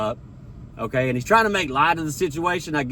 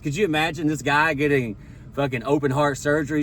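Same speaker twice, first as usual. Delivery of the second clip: ordinary delivery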